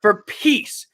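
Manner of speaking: ordinary talk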